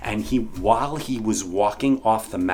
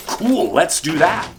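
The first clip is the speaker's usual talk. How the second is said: eager agreement; 7 semitones higher, 4 dB louder than usual. Retold or imitated. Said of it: "I was like".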